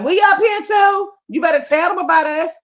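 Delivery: high, indignant inner voices; people upstairs. Retold or imitated. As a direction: pipe up high, demanding to be included. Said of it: "There's people upstairs that's like".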